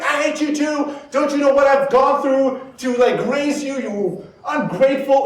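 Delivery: high-pitched parental outburst; mock parent screaming back. Parent screaming back at the child, mock furious. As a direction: act out an exasperated parent losing it. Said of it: "start screaming back at the child".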